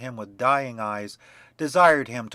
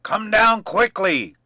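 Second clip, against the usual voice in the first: loud, higher, slow furious yell; angry shout; 9 semitones higher, 10 dB louder, slower than usual. A narrator's reading. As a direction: bellow the order up the stairs. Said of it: "cried Bluebeard".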